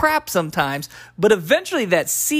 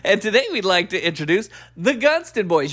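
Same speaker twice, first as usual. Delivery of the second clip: ordinary talk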